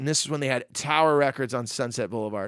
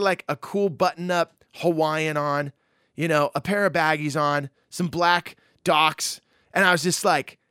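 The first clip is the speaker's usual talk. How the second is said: usual speaking voice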